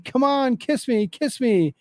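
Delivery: high, pleading mock yell; mild mock yell. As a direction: imitate her demanding kisses. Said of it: "the woman starts yelling".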